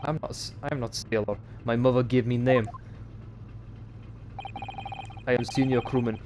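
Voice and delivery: put-on proud Russian sailor accent. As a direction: insist on your rank in a Russian accent. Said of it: "I like to do Russian accents".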